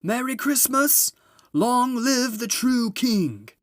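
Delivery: high, loud and slow jubilant shout; Father Christmas cheering as he leaves; cried out. A narrator's reading. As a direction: call it out big as he drives off. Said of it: "Then he cried out".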